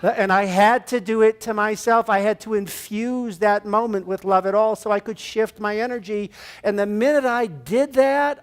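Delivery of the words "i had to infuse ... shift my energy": ordinary delivery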